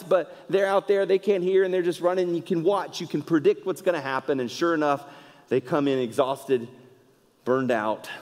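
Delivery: ordinary delivery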